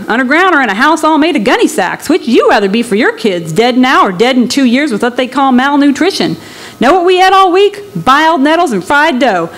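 a desperate father arguing his case; bitter migrant. Acted.

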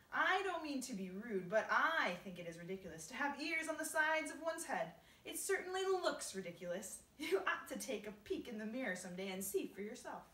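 loud, high mocking figure; cheeky centipede. Centipede ridiculing James's ears, loud cheeky mockery. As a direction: taunt brashly at full voice.

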